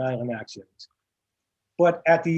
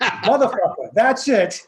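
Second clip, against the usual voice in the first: mock swear; 6 semitones higher, 6 dB louder, slower than usual. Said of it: "She says".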